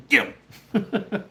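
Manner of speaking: sic the dog, half laughing; urging the dog on. Retold or imitated. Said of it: "I was like".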